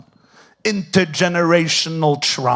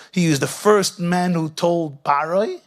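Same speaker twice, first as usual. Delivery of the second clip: ordinary delivery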